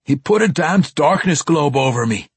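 worked-up, grumbling dwarf; outraged grumble. Acted. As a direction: grumble with real outrage at being tricked. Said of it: "Bruner grumbled, stamping his foot in outrage".

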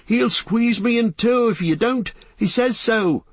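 very high, frantic pleading; panicked cry. A narrator's reading. Trapped Merry warning of being crushed, panicked high cry. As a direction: plead desperately, pitched right up. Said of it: "cried Merry".

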